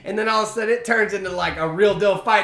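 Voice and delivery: usual speaking voice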